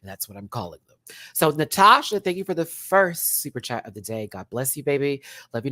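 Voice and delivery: usual speaking voice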